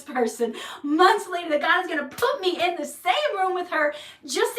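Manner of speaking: high, loud, laughing reproach; exasperated scolding. Retold or imitated. God reproaching the speaker for missing her chance, loud laughing rebuke.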